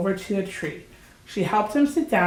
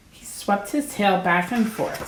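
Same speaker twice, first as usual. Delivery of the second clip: usual speaking voice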